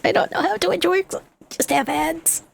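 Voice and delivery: high squeaky put-on voice. Repeat in high far voice.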